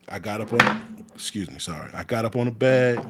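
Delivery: ordinary talk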